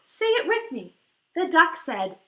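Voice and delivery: plain reading voice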